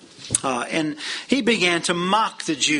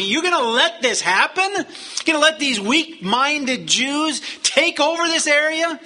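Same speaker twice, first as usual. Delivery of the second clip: rabble-rousing tyrant; 9 semitones higher than usual. Acted. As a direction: whip a crowd into fury with mocking questions. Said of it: "this is the kind of thing he's doing".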